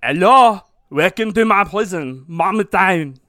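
loud, hammed-up French prison guard greeting; mock French accent. Guard greets the prisoner in mock French accent. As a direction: ham up a French accent with a bit of Arnold. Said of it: "He went a little Arnold there".

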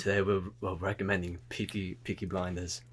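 very low, soft British voice; low put-on British voice; harsh British voice. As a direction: announce it as a quiet British tough. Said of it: "that's the great voice".

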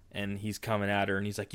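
ordinary delivery

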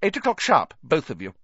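a hint of a strict teacher, high then dropping; stern order. A narrator's reading. Strict teacher dismissing two pupils, stern. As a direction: give a firm parting order.

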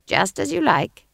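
lightly voiced old witch; a hint of the witch's voice; Granny's character voice. A narrator's reading. Witch giving in to the guard, lightly voiced role. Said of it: "said Granny".

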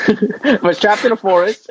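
laughs through the words, pitch up; giggling while explaining herself; laughing. Real, not acted. Laughing while retelling odd feeling.

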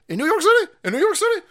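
high, rising incredulous question; mock disbelief. Ranting about defending property there, high incredulity. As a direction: squeak it upward in exaggerated disbelief.

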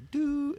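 high, sustained mouth-made buzzer tone; imitated door buzzer. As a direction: mimic an intercom door tone, high.

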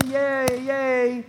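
high, sing-song fake cheerfulness; mock the pretend happy clappy crowd; mock cheer. Pastor mocking fake joy to congregation, mock cheer. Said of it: "Not happy clappy all the time".

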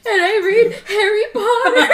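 high, loud wail through laughter; laughing, mock crying. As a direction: sob it out while cracking up. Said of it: "I was crying".

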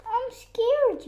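high-pitched, acted frightened cry; scared yell. Raccoon hiding from the bear, scared high yell. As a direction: call out high in mock fright. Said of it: "yelled Raccoon".

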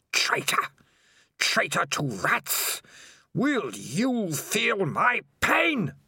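high, slow, sneering creature voice rising to rage; raging voice. Acted. Evil Spider raging at Dangerous Beans, high and slow. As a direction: taunt him then build to fury. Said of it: "The voice raged in Morris's mind".

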